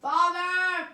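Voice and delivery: high, loud call across the house. Real, not acted. Kid calling his father from the other room, loud. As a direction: shout for your father from another room.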